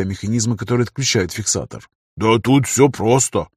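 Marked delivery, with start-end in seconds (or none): 2.2-3.5 slow, drawn-out know-it-all explaining; a touch of showing off; self-important. Acted. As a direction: draw it out with mild importance. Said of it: "подскочил тот с важным видом".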